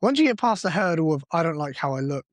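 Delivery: usual speaking voice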